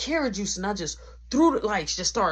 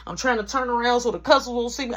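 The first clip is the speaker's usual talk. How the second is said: ordinary talk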